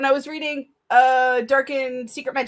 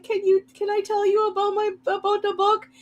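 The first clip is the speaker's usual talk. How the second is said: eager high plea; 10 semitones higher than usual. Retold or imitated. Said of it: "I'm like".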